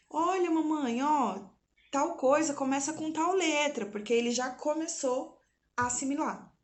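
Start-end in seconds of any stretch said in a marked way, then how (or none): 0.1-3.9 1 lightly voiced child. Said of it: "vindo me falar".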